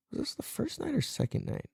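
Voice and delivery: hushed, nearly voiceless aside; soft whispered question to self; muttering to self. Real, not acted. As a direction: mumble it to yourself while trying to remember. Asking self, trying to remember.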